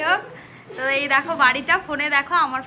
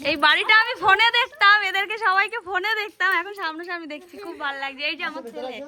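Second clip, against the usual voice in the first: normal talking voice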